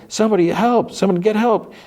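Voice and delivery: very high, strained mock cry for help; calling for help. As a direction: imitate a desperate call without real volume. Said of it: "She calls".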